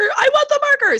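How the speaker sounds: put-on high, loud toddler demand. Mom imitating her toddler's high loud demand.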